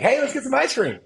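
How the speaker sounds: put-on high, childlike voice of the young son; mock little kid begging for ice cream; kid's voice. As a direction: pitch way up like an eight-year-old asking for ice cream. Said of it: "Nicholas is in the back seat like".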